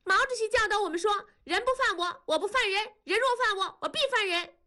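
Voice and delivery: put-on high, fast child reciting a slogan. A narrator's reading. Girl quoting Chairman Mao at her mother, high. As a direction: recite it like a proud schoolgirl. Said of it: "燕妮却说".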